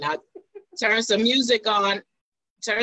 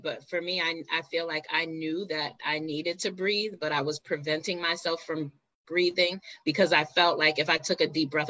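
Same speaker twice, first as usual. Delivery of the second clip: ordinary talk, nothing marked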